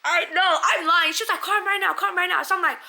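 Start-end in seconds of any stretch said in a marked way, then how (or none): 1.4-2.4 high, loud, frantic demand; imitated spazzing. Retelling the car owner demanding a call, imitated spazzing. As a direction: mimic someone frantic, loud and insistent. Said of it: "She's spazzing, like, she's spazzing".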